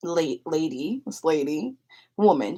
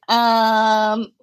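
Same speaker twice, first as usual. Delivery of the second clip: loud, raised, held-out um; stalling; 5 semitones higher, 7 dB louder than usual. Retold or imitated. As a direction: stretch out a hesitant um. Stalling when asked to pay.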